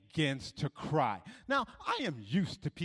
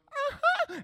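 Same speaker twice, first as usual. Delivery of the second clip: very high squeaky mock-tearful assent; imitated crying girl, pitched way up; tearful girl agreeing; 4 dB louder than usual. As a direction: squeak out a yes through pretend tears.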